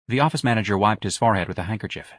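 ordinary delivery, nothing marked

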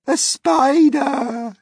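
high, loud, slow outcry; shocked cry. Retold or imitated. Miss Spider imitating Aunt Sponge crying out in horror. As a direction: cry out in disgust. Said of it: "she cried".

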